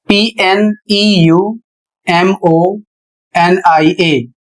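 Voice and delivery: पीएनईयूएमओएनआईए spelled letter by letter, very slow; spelled out slowly. Shown as a demonstration. Teacher spelling the word slowly for students. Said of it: "जैसे यहाँ पर उदाहरण देखिए".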